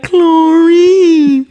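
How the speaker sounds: loud, high teasing name-call; mocking schoolboy taunt. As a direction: taunt her with the nickname, singsong and loud. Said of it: "He'd be like".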